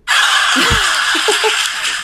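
high loud burst of laughter. Real, not acted. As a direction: let out a big laugh. Laughs at own story.

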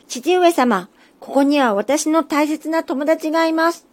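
the little tengu stands up to his father; high and firm. Acted. Little tengu tells his father his friend is here, high firm. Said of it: "甲高い声できっぱり言いました".

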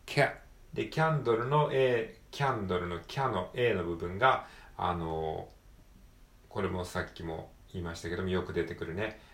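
ordinary talk

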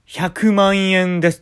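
loud, slow, drawn-out prize reveal; mock game-show announcer; announcing the prize. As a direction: boom out the amount like a show host. Host announces million yen prize.

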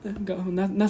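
ordinary delivery

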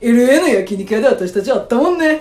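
loud, put-on singer's voice. Impressionist as the singer reminds the comedian how they met, loud impression. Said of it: "愛さんのモノマネを".

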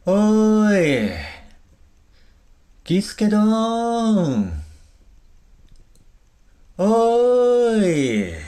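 long high shout into the dark; calling out. Acted. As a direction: call out loudly, drawn out. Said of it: "声を張り上げ探し回った". (大声で長く呼びかける)

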